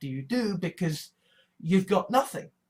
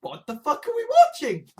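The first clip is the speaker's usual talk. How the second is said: high, louder disbelieving cry; exasperated outburst; 7 dB louder than usual. Retold or imitated. Viewers reacting to the were shark, high loud exasperation. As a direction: yelp it in disbelief, much higher. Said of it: "and say".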